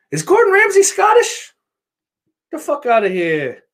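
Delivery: put-on Scottish accent. Gordon Ramsay brought up.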